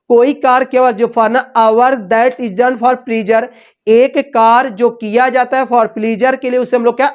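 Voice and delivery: normal talking voice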